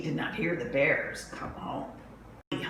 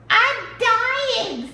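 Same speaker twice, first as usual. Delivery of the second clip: very high, loud, melodramatic whine; baby bear; 8 dB louder than usual. Acted. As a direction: wail like a tiny dramatic cub. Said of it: "said Baby Bear".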